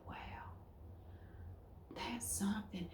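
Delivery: hushed, amazed whisper of a stranger; mock-amazed northerner whispering; awed whisper. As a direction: breathe it out like you've seen an exotic bird. Awed strangers staring at her.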